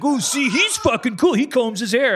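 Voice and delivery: high-pitched mock admiration; mocking bully imitation. Bully making fun of a nerd's hair, mocking. Mimicking sneering bullies' remarks.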